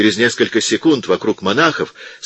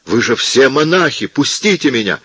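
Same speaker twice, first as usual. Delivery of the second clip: shouting; 6 semitones higher than usual. A narrator's reading. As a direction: shout at the monks to release him. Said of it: "крикнул Аццо".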